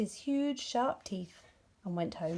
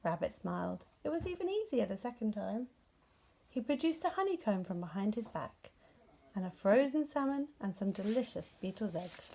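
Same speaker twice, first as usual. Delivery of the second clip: ordinary delivery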